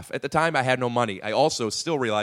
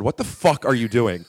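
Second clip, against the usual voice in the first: slow, louder retold anger; serious mock outrage, no joking; mad, not comedy; 5 dB louder than usual. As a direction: imitate an angry man behind the camera. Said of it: "Mad, not comedy".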